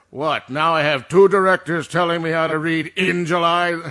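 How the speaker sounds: loud, exasperated complaint; affronted and incredulous; indignant outburst. Acted. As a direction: protest loudly at being directed. Indignant at two directors.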